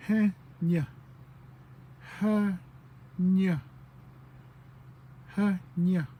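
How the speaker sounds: "he nya" in two clear, emphatic beats; added "he" sound. Shown as a demonstration. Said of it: "different way of saying".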